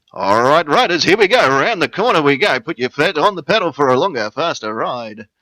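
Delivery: loud, high dodgem-car barker; carny voice. Shown as a demonstration. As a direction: holler the fairground ride spiel. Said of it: "refer to it as the carny voice".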